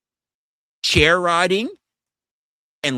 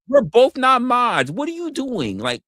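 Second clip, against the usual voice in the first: exasperated; 11 semitones higher, 5 dB louder than usual. Real, not acted. Exasperated at a chatter.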